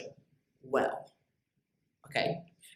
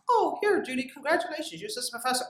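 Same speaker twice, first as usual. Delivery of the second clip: bright, much higher voice imitating her boss; boss's congratulations; 6 semitones higher, faster than usual. Speaker retelling her boss promoting her, mock cheerful. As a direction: play the cheerful boss handing out the promotion, high.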